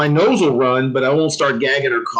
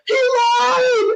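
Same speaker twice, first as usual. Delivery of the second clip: much higher, crying shout; mock crying yell. Storyteller voicing gassed recruits accusing the drill sergeant, in a high crying mock yell. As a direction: wail out the betrayal like gassed recruits. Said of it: "everybody's like".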